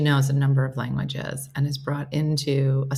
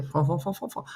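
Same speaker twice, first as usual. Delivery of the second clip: mock French babble; 6 dB quieter than usual. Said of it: "thought of Catherine too".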